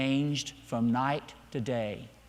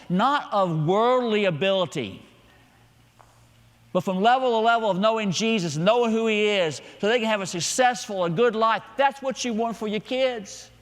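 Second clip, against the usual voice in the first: forceful preaching; 6 semitones higher, 4 dB louder than usual. Real, not acted. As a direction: build to a high, loud preacher's pitch.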